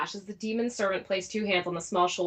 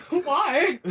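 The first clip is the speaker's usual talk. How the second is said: incredulous; 7 semitones higher, 4 dB louder than usual. Retold or imitated. Incredulous quote, far, high.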